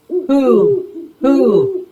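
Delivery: high, hollow ghostly hoot; strange hooting. A narrator's reading. A mystery caller answering Big Bird, high ghostly hoot. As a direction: hoot like something unseen in the trees. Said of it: "heard a strange sound".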